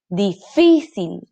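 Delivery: slow Difícil, stress on fí; syllable by syllable. Shown as a demonstration. Teacher sounding out Difícil, slowly. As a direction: stretch Difícil leaning on fí. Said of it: "¿Dónde se carga la voz?".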